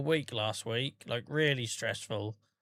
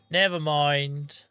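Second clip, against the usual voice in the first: drawn out slowly and louder; 4 dB louder, much slower than usual. Shown as a demonstration. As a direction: stretch the words out slowly.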